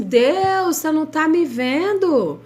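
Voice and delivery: high anguished cry to God; desperate plea to God. Retold or imitated. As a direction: plead like someone who feels abandoned. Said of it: "parece assim".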